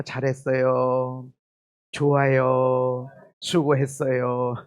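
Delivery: gentle slow encouragement; gracious kind words. Shown as a demonstration. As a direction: soothe as if praising a tired spouse. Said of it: "은혜로운 말만 하세요".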